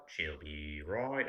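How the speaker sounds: very slow, drawn-out Aussie "She'll be right"; Australian phrase practice. Retold or imitated. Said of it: "say it in different ways".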